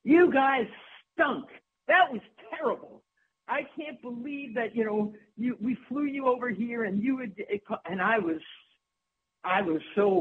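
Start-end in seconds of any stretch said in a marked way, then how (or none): 0.0-7.3 producer scolding. Retold or imitated. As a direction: chew them out like an angry producer.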